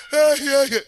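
high, loud short mock cries; mock crying out. Preacher showing what Jesus didn't say, high mock cry. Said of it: "He didn't say".

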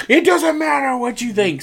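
very high-pitched hammed-up interruption; imitating a wrestler's catchphrase; mock catchphrase yell. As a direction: cut them off with a high mock holler.

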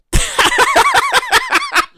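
very high, rapid cackle; cackling laugh. Real, not acted. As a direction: break into a long squealing laugh. Laughs after ending the show.

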